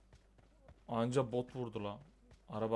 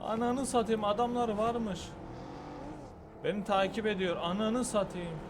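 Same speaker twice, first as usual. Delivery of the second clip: being chased; 9 semitones higher than usual. Real, not acted. Enemies follow him.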